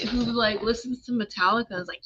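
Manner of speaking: normal talking voice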